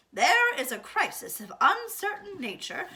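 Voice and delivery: loud, clipped official phone report; brisk emergency call. Acted. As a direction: report it firmly, in charge. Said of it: "said Eugenia Lincoln".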